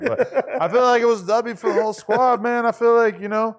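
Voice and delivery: high, loud excited boast. Real, not acted. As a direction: proclaim it like a win. Proclaiming victory.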